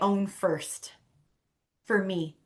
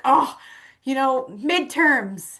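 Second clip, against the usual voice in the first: louder, higher, drawn-out groaning excuse; mock stressed excuse; 4 semitones higher, 4 dB louder, slower than usual. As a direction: play the swamped friend begging off. Acts out busy friend making excuses.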